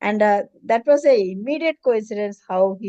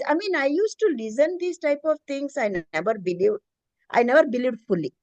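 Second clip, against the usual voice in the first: ordinary talk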